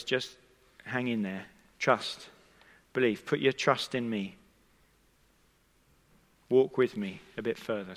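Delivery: low, soft, spaced-out words of comfort; steady a grieving father gently, pausing between phrases; hushed, calm retold encouragement; quiet reassurance. Jesus steadying bereaved Jairus.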